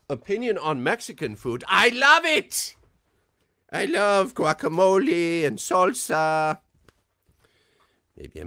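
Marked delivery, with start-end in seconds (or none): 0.1-6.6 high, loud German doctor voice; Medic character voice. Acted. Answering a fan's question as the Medic. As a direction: boom it out as the Medic.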